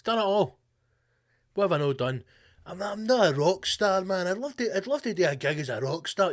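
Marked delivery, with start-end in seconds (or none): 2.7-6.3 Trump dreaming. Retold or imitated. Trump voiced wanting rock stardom.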